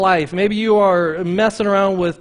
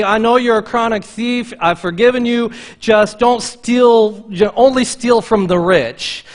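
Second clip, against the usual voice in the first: mildly louder, hammed-up easygoing Jesus; mock lenient Jesus; 5 semitones higher, 5 dB louder than usual. Preacher mocking a lenient Jesus, lightly hammed-up. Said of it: "That's not what Jesus says".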